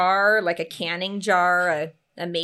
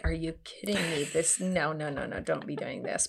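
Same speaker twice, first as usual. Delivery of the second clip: mock mutter; 3 semitones lower, 10 dB quieter than usual. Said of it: "he was just like".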